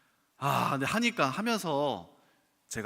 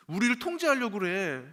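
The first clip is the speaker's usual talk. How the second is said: put-on high, whiny kid complaint; complaining child; 8 semitones higher, 6 dB louder than usual. Student telling mom the school is getting scary, childlike complaint.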